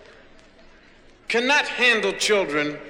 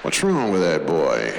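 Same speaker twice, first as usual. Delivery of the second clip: low, slow father's grumble; a puzzled father, pitched low and slow; exasperated father; 10 semitones lower than usual. Retold or imitated. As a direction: sound like a baffled dad. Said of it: "he was always saying".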